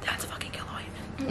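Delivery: ordinary talk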